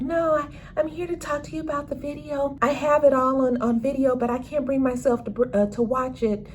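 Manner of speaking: put-on high, soft, timid girl's voice; Gemma's timid voice. Teen girl telling Trig about the video, high and timid.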